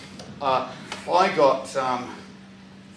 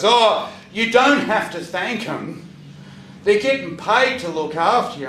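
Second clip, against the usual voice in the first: mock smug dismissal; 5 semitones higher, 5 dB louder than usual. Disliked patient voiced dismissively.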